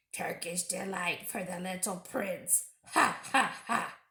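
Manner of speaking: sneering dwarf laugh; mocking dwarf. Dwarf taunting Edmund with bread, jeering. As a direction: jeer at the boy with a cackle. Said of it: "He grinned in a repulsive manner".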